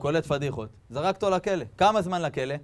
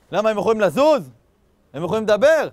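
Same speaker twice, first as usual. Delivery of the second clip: raised, high-pitched acted indignation; indignant retort; 7 semitones higher, 9 dB louder than usual. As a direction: throw the questions back loudly. Acted incredulous father defends statues.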